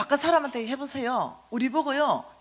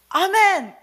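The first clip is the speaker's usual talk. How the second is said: loud, higher ringing amen; fervent amen; 6 semitones higher, 7 dB louder, much slower than usual. Retold or imitated. Answering the Lord's question, a loud fervent amen. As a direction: call out amen with full conviction. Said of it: "이랬습니다".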